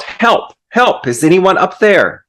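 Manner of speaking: high, acted call for help; mock yell for help. Man on a branch to anyone up above, mock yelling. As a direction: call upward like someone stranded, half-voiced yell. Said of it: "And so he yelled".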